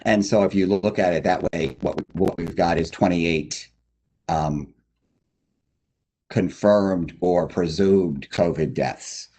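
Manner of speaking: normal talking voice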